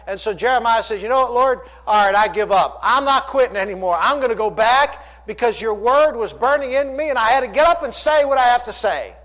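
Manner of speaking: high, loud sermon retelling; impassioned preaching. Acted. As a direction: preach the prophet's renewed resolve. Preaches Jeremiah's answer to God.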